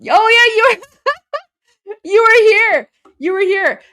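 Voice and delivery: loud, high, delighted greeting with a laugh; excited recognition. Real, not acted. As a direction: call out to a familiar face, thrilled. Spots a returning viewer live.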